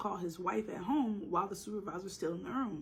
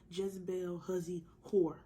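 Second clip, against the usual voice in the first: ordinary talk, nothing marked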